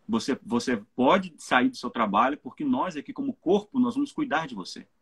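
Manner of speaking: ordinary talk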